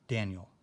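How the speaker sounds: "Daniel" with a firm accent on "Dan"; first-syllable stress. Shown as a demonstration. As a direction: punch the start of "Daniel", let the end fall. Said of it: "the accent's in the first syllable".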